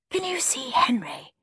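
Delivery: soft, high whispered question. A narrator's reading. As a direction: whisper to your husband in the audience. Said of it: "whispered Henry's mum".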